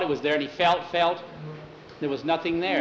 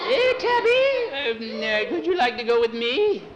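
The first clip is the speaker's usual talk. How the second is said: high, teasing witch invitation; high-pitched storybook witch; 10 semitones higher than usual. Acted. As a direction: coax the boy in a high put-on witch voice. Witch invites boy to ride.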